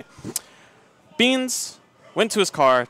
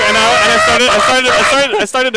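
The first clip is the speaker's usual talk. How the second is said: louder and higher, stumbling start; pushing through the words excitedly; telling the climax of the officer story, louder; excited storytelling; 5 semitones higher, 9 dB louder than usual. Real, not acted.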